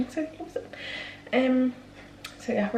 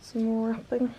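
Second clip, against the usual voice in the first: ordinary delivery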